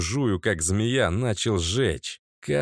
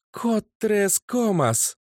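calling out; 11 semitones higher, slower than usual. A narrator's reading. Mage calls radio command.

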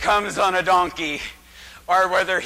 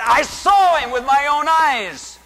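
eyewitness exclaiming; 7 semitones higher, 6 dB louder than usual. Acted. Crowd member exclaiming.

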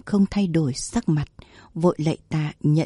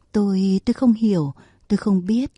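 plain reading voice

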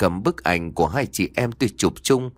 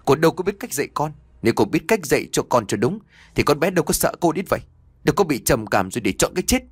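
higher, fast angry rebuke; angry scolding; 6 semitones higher, faster than usual. Acted. Father blaming the mother for their daughter's death, angry and fast. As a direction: tear into her for failing the child. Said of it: "Bố nói tức giận".

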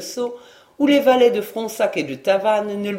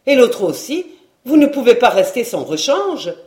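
louder, higher pushing; insistent maid; 4 semitones higher, 6 dB louder than usual. A narrator's reading. Maid pushing her master to buy both suits, louder. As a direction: insist he can't do without.

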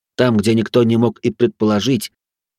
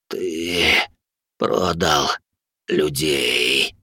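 low dying rasp; hoarse croak; 6 semitones lower, much slower than usual. A narrator's reading. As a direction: croak it out, poisoned and fading. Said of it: "прохрипел он". (хриплый предсмертный шёпот)

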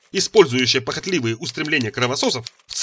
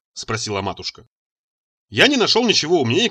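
usual speaking voice

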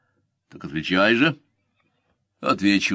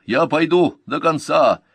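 high, loud, slow vow; pleading insistence; 7 semitones higher, 5 dB louder than usual. A narrator's reading. Slinger begging to stay on the march, pleading insistence. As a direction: implore with determination, words stretched. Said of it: "взмолился Бармакар".

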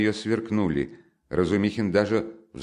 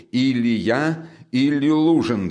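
high, raised, insistent voice; angry ultimatum; 6 semitones higher, 4 dB louder, slower than usual. Acted. Brother forcing his sister to choose, angrily. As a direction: build louder on the second choice.

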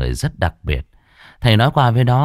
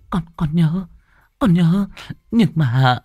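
nervous stammer; 8 semitones higher, slower than usual. Acted. Girl stammering to medium.

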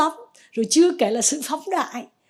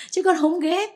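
a lightly voiced protesting disciple, higher; insisting disciple; 8 semitones higher, 4 dB louder than usual. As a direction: protest it, a bit louder. Đệ tử phân trần với thầy. (đệ tử phân trần hơi cao giọng)

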